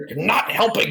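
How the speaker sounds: high, exasperated protest; playful reproach; mock scolding. Scolds friend while punching his shoulder.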